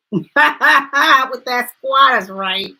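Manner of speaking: loud, drawn-out words after a laugh; laugh, then say it slow, high and loud; amused, stretched-out delivery. Real, not acted. Amused by viewer's squatter trouble.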